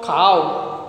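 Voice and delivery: "cow" as ka-oo, pitched low; correct diphthong. Shown as a demonstration. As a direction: model "cow" with an open ah gliding to u. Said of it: "No ow sound in English".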